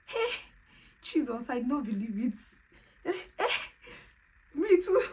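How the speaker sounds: high, loud, near tears with joy; overjoyed disbelief. Real, not acted. Seeing two lines at last, crying for joy. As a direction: squeal it like you can't believe it. Shock at positive pregnancy test.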